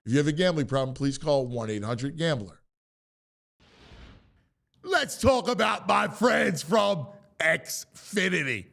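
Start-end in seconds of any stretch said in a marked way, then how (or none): 4.8-8.7 ad read. Acted. Ad read intro.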